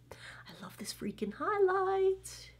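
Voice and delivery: breathy whispered gush. Real, not acted. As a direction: whisper it like a delighted secret. Gushing over highlighter, whispered.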